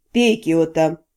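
deep, calm friend voice; low male voice. A narrator's reading. Friend urges her to drink.

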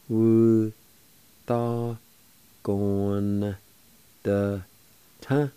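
each syllable held alone, "Wu, ta, gon, the, ta.". Shown as a demonstration. Teacher first showing learners "Wu, ta, gon, the, ta.", syllable by syllable. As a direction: break "Wu, ta, gon, the, ta." into long, separate syllables. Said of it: "pronounce the N sound with the G O like this".

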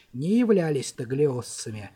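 plain reading voice

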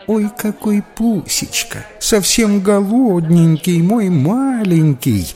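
put-on high cooing baby talk, slowing down. A narrator's reading. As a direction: coo over a little pet like a baby. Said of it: "засюсюкала блондинка".

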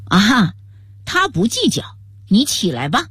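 ordinary delivery

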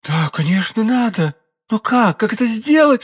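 high-pitched, urgent question; anguished cry. A narrator's reading. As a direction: cry out, desperate for a way. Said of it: "вскричала королева".

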